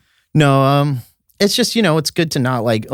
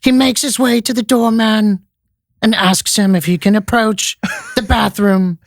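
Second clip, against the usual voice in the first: Attenborough-style narration; 10 semitones higher, 3 dB louder than usual. Pretend. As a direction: narrate a homeless man like a wildlife scene, with mock gravity. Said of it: "Do a homeless person on Sixth Street".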